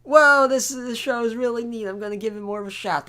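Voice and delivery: suddenly thrilled discovery, fast finish; excited. Retold or imitated. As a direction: gush like a newly won-over fan. Quoting an impressed new viewer.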